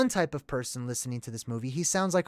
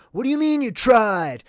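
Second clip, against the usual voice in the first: higher and louder mock outburst; Nick Cage freaking out; 8 semitones higher, 9 dB louder than usual. Father yells at daughter, imitating Nick Cage's loud outburst. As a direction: blow up at your daughter. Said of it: "This is Nick Cage classic freaking out".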